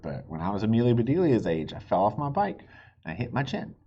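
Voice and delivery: plain reading voice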